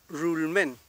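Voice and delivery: RULMEN slowly, with untrilled first r; correct Mapuche r. Shown as a demonstration. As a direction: draw out RULMEN with English-like r. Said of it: "Esa es la forma".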